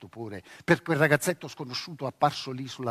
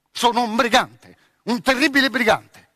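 boastful; 7 semitones higher, 9 dB louder than usual. Acted. Boy boasts to scare her.

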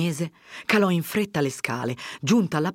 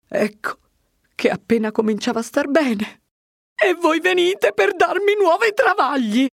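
whining protest climbing much higher and louder; aggrieved complaint; 6 dB louder than usual. A narrator's reading. Old curate grumbles at his visitor's new troubles, aggrieved. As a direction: complain as if put upon, pitch soaring. Don Abbondio complains to Fermo.

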